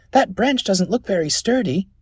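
put-on high, doubtful boy's voice; high-pitched cautious lad; worried boy. Boy warns friend in tree.

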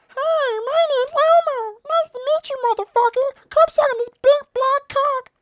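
very high, loud puppet voice; Elmo. Shown as a demonstration. Reactor showing off his Elmo voice, squeaky high Elmo impression. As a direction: do an over-the-top Elmo impression. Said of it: "I can do the Elmo voice better".

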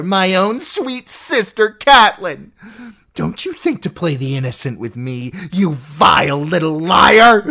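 a sister ranting about Catelyn; venomous aunt. Acted. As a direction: spit out jealousy. Lysa ranting, rising.

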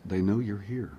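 quiet eerie wife voice. Retold or imitated. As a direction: say it quietly like a trance. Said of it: "and says".

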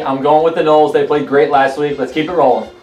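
ordinary talk

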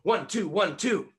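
high, loud boxing count, each number hit hard; punched count. Shown as a demonstration. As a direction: call it out like a boxing trainer.

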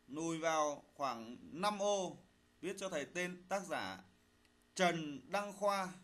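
slow dictation, phrase by phrase; dictating. Shown as a demonstration. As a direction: read it out slowly for pupils to write. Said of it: "lắng nghe thầy đọc bài, chúng ta soát lỗi".

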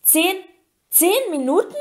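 high, rising incredulous question; alarmed disbelief. Acted. Harry learns the task starts shortly, alarmed disbelief. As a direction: repeat it in rising shock.

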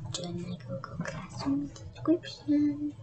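soft whispered goodbye; whispered sign-off. Real, not acted.

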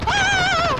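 high sharp yelp of alarm; startled yelp. Real, not acted. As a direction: yelp as you get hit. Low health in firefight.

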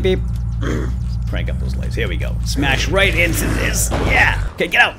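ordinary delivery

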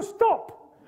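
a very high, strange-sounding "stop". Shown as a demonstration. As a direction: squeak "stop" as written on the Russian sign. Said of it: "but it's still".

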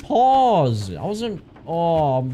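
ordinary talk

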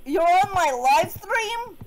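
loud, high, drawn-out question; slow, high incredulous outburst; shocked disbelief. Real, not acted. Brother interrupts the live stream.

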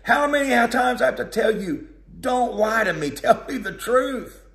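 a king fed up with being lied to; exasperated king. Acted. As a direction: scold the prophet who keeps lying. Ahab scolding Micaiah.

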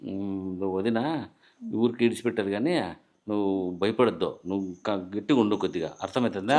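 low, soft confidential voice; hushed advice. Acted. Husband privately tells wife to stand firm against sister-in-law, low and hushed. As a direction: coach them secretly under your breath.

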